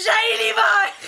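high, strained yell at the cold; mock cry of frozen rage; winter shout. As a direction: scream your hatred of winter. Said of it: "je dis".

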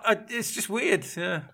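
ordinary talk, nothing marked

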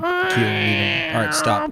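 very high cat voice. Pretend. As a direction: squeal it like a hungry cat. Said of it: "I can do a good cat".

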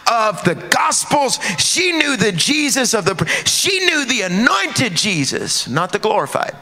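slow, high, sung-out preaching; whooping preacher cadence; 1 preaching crescendo. Acted. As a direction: lift it high and draw each word out. Preaching peak, sung and shouted.

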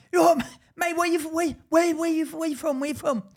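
high-pitched, repetitive badgering; mimicked pestering stranger. Mocking the stranger asking where he's from, high and pestering. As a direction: put on a nosy lad, stuttering the question up high.

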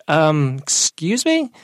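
high, incredulous retold question; taken aback. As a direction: pause on the um, then leap up in disbelief. Taken aback by customer's remark.

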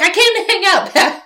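high and loud buddy-cop greeting; a hammed-up eager detective; voicing Gumshoe dropping by, high and chummy; goofy Gumshoe. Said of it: "Gumshoe comes in and he's like".

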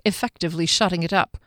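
ordinary delivery, nothing marked

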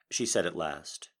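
ordinary delivery, nothing marked

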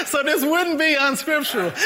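high, loud words through laughter; laughing while talking. Real, not acted. Preacher joking that shirtless preaching is scriptural, laughing through it. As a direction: squeeze the words out mid-laugh.

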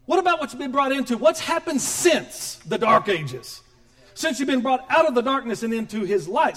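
loud, rising sermon delivery; full-force preaching. Acted. Pastor preaching loud to the congregation. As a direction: preach it out with fire.

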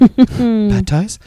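put-on high, loud, pleading toddler voice; coy little voice. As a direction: beg like a sweet little kid. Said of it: "In his little coy voice".

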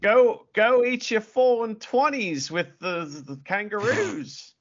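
teasing imitation of an Australian; mock Aussie accent. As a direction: jeer in a hammed-up Aussie accent. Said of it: "is that how you talk".

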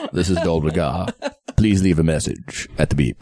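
low answering-machine greeting; low, mock-formal phone message voice; voicemail greeting. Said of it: "Well, hello, Teresa".

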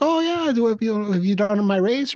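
lightly voiced fake-friendly race director; high mock cheerful. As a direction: sing it out high and chipper. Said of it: "You're like".

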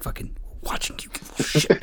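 fast threatening whisper. Retold or imitated. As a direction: hiss the warning under breath.

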